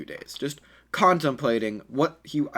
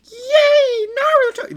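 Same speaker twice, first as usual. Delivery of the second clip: much higher, louder, giddy fangirl squeal; squealing cheer; 9 dB louder, much slower than usual. A narrator's reading. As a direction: squeal with delight at your hero. Girls cheer as he returns.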